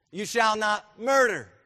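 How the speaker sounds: high, loud, slow declaration; forceful commandment. Acted. Preacher citing the sixth commandment, forceful. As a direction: thunder it out word by word.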